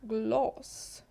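«глас» with the A rounded toward O; «глас» said as glos, rising; «глас» Swedish, dark A near O; Swedish glas. Shown as a demonstration. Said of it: "то ми скажемо".

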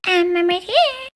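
high, drawn-out mocking echo; mocking mimicry. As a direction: parrot the words back in a silly high voice. Mocking echo of Emily's words.

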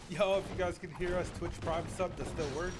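calling out. Real, not acted. Calling out to chat.

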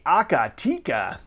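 slow Akatika, "ah-ka-TEE-ka"; maker's pronunciation. Shown as a demonstration. Host showing how the company says it, maker's pronunciation. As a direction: sound out Akatika with an "ah" second vowel. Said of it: "that's how they say it".

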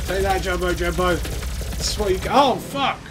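loud battle taunt; yelling while fighting; excited. Real, not acted. Fighting the boss.

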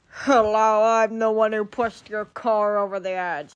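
menacing put-on monster voice. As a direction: gloat like the beast that pushed the car. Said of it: "it's a monster".

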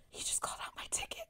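fast, hushed whisper; secretive whisper. Retold or imitated. Friend telling her about the called ticket, secretive whisper. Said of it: "Like it's a secret".

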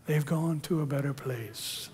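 soft, hushed funeral platitude; ethereal. Acted. Christians consoling at a funeral, ethereal and hushed. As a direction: say it softly, very ethereally. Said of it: "we say it very ethereally".

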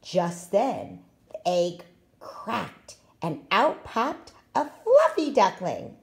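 ordinary delivery

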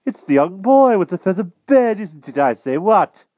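put-on high, loud, blustering old-fashioned knight; excitable King Pellinore. A narrator's reading. Knight king recognises the boy, excitable.

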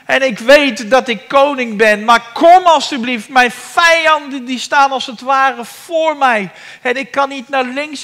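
high, loud anguished pleading; crying out. Acted. David trapped by enemies crying to Yahweh. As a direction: shout to God from a trap. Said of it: "hij roept het uit".

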